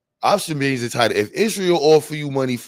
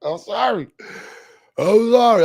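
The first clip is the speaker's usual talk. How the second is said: laughing; 12 semitones higher, 6 dB louder than usual. Real, not acted. Laughing apology, loud and high.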